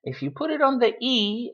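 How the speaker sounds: ordinary delivery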